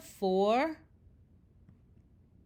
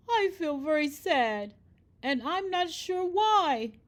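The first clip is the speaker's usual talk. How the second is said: wailing; 7 semitones higher, 4 dB louder than usual. Acted. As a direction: wail it out, lost and miserable. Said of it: "wailed the orangutan".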